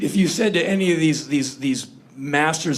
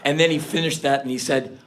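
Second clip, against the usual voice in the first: ordinary talk, nothing marked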